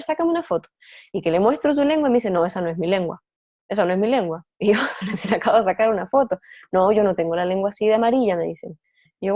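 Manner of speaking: normal talking voice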